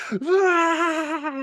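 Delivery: high mock shriek of a child under cold water; imitated shriek. Father imitating son's shriek in the cold shower: high mock squeal.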